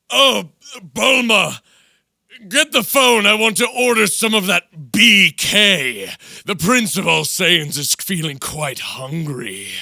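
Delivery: loud, high, proud Saiyan prince bellowing a fast food order; hammed-up Vegeta ordering Burger King. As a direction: puff up like an arrogant prince demanding lunch. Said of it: "as, like, Vegeta".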